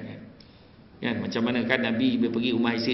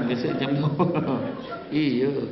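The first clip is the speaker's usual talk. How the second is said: usual speaking voice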